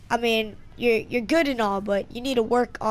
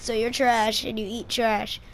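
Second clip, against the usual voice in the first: ordinary talk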